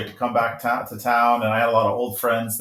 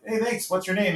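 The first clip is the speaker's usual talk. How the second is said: mock casual self; 7 semitones higher, 3 dB quieter than usual. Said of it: "And I'm like".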